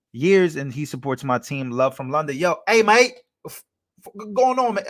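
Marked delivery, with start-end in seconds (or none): 2.4-3.1 loud, high mock-English greeting; hammed-up bad London accent; badly faked English mate-speak; terrible English accent. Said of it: "that is a terrible accent". 3.5-4.8 high-pitched mock-English greeting; badly faked London accent; terrible English accent. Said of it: "that is a terrible accent".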